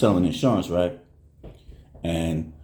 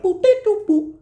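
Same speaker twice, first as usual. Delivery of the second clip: very high playful sing-song beeps; mock clicking sounds. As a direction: chirp the quick fix like nothing. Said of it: "My daughter was like".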